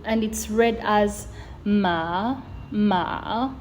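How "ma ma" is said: "ma" drawn out with an upward glide; rising tone. Shown as a demonstration. Teacher showing how it's read, rising tone. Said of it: "it's a rising tone".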